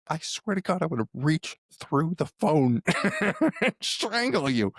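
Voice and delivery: mock threat. Threatening mom in jest.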